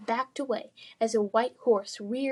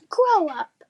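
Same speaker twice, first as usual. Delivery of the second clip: high, loud snapped retort; sharp retort; 8 semitones higher, 7 dB louder than usual. Acted. Lily snapping back at the bully, loud and high. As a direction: fire it back at him.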